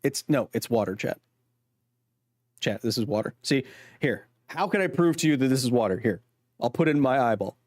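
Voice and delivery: ordinary talk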